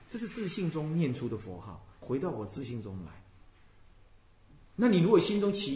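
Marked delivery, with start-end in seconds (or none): none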